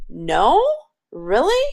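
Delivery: doubtful rise on "No?" and "Really?", asking are you sure; questioning no. Shown as a demonstration. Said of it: "he said it more like a question".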